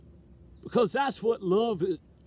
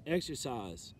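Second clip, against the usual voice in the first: normal talking voice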